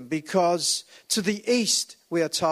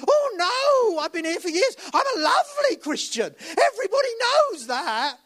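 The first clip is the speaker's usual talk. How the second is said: much higher, louder, with a hammed-up cry; 5 dB louder than usual. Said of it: "the real person".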